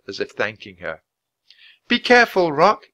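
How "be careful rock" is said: high, loud farewell warning; shouting. A narrator's reading. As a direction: call up to a bird flying away. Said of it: "Annie shouted".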